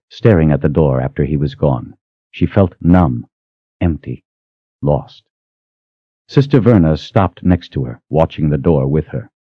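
ordinary delivery, nothing marked

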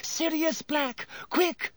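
high panicked scream for help. A narrator's reading. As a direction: shriek urgently for rescue. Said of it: "Hermione screamed suddenly".

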